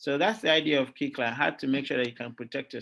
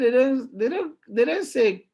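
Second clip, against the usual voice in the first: higher, louder voice of a son correcting his dad; a lightly voiced son, higher and louder; imitating son; 9 semitones higher, 5 dB louder than usual. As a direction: playfully mimic the son correcting you. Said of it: "He said".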